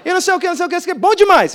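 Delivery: high, loud mock raving; gushing mineira woman. Pastor parodying the churchgoer's repeated praise, gushing. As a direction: build to an over-excited imitation.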